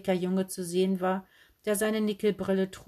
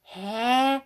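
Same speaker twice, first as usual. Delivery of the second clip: louder, higher, rising huh; baffled; 4 semitones higher, 5 dB louder than usual. Acted. Girl hears a puzzling proverb, baffled. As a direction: blurt out total incomprehension.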